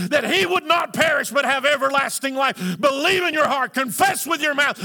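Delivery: high, loud, fervent shout; the way a preacher should tell someone to believe, shouted; shouted preaching. Shown as a demonstration. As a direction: preach it hard and personal. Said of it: "Instead of saying".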